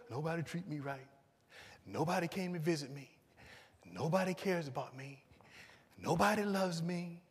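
soft mock pity-party voice; negative, self-pitying. Negative relative complaining to the visiting speaker, mock self-pitying. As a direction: play a quiet, woeful complainer listing grievances. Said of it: "she's always just negative".

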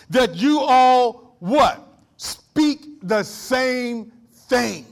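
very slow, loud, every word spaced out; preaching emphasis. Acted. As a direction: hammer the verse home slowly.

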